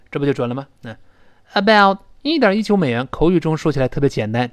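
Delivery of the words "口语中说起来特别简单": ordinary delivery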